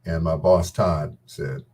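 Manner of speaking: normal talking voice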